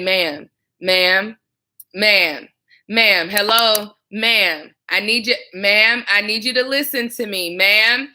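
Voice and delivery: "Ma'am, ma'am" called firmly over a frantic caller. Shown as a demonstration. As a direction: raise the volume to get the caller's attention. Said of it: "but you say".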